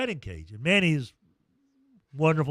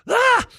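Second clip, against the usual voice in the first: sudden squeal, much higher and louder; excited squeal; 10 dB louder than usual. Retold or imitated. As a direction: squeal like a thrilled kid. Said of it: "She gets so excited, she's like".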